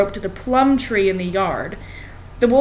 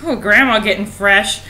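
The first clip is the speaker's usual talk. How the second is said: usual speaking voice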